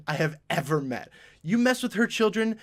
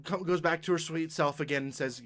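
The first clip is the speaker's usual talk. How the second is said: ordinary talk, nothing marked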